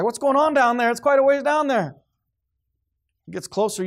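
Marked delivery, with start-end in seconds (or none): 0.0-1.9 higher, louder, anxious calling out as he peers ahead; a worried man craning to see the front; nervous man in line. Retold or imitated. Man waiting at the executions calls down, uneasy. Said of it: "you're like".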